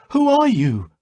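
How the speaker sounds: sharp challenge to the stranger; demanding. A narrator's reading. Giui confronting his father's ghost, demanding. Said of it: "Giui demanded".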